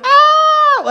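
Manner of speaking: high, loud thrilled scream; coaster scream. Retold or imitated. Riders on the roller coaster, screaming with fun. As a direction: shriek with joy on the ride. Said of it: "rather than like".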